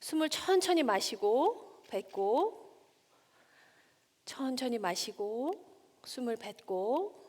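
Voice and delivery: slow, soft voice that leads the breathing; slow breathing guide. Shown as a demonstration. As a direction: draw out each word so they breathe along. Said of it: "크게 심호흡을 한번 해봐 주세요".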